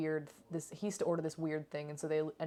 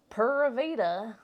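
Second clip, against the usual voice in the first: "Pura Vida" hammed up in a thick American accent, slow and loud; mocking American-accent "Pura Vida"; 4 semitones higher, 7 dB louder than usual. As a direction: tease him by copying his American "Pura Vida". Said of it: "with such a strong American accent".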